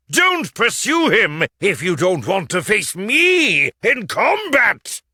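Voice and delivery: loud, high furious challenge; angry shout. A narrator's reading. Knight defending the puppet hero, shouting furiously. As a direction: roar at the villains to stop. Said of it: "Don Quixote stood up and shouted".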